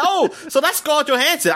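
high, mock-amazed "oh" of discovery; retold realization. Retold realization about the character.

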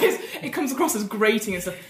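usual speaking voice

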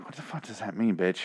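softly voiced baffled reaction; quiet retold bafflement. Viewer recalling a reaction to a puzzling line, quiet bafflement. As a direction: say it low, as an aside.

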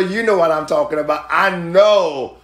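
ordinary talk

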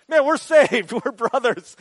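high and loud, laughing with joy; joyful exclaim. Retold or imitated. Preacher recalling bonding with the man, exclaiming with joy. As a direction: celebrate like you just found a brother. Retold feeling of brotherhood.

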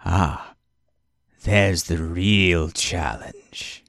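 slow, low, sinister villain line; menacing swordsman. Acted. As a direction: savour it like a cold villain. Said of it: "looks at you and goes".

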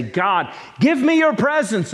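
high, loud pleading cry to God; begging plea. Retold or imitated. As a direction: beg God desperately, voice climbing high. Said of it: "you can beg and plead and say".

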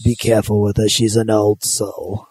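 put-on like a creepy old sailor; spooky old-timer. Repeats the mock warning.

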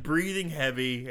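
ordinary talk

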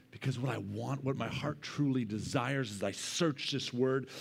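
ordinary delivery

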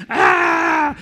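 a high, loud overwhelmed shout; preacher reliving the moment, yelling in amazement; excited yell. Retold or imitated. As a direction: let out a burst of amazed shouting. Overwhelmed by the sign.

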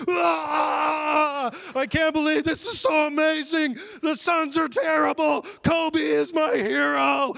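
high, ecstatic fan shouting; losing his mind. Retold or imitated. Preacher re-enacting himself watching Kobe win at home, wild high shouting. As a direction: scream it like a fan at the winning shot. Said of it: "I was losing my ever-loving mind".

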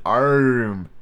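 "arm" drawn out slowly with a strong r; "arm" said slowly as the servant pronounces it; dialect pronunciation. Shown as a demonstration. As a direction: stretch "arm" toward "arrum". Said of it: "he pronounced it arm".

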